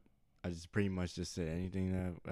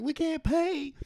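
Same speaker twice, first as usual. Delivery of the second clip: high, loud mock Louisiana drawl; Louisiana accent; 10 dB louder, slower than usual. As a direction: imitate Oscar's Louisiana accent. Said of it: "Louisiana accent".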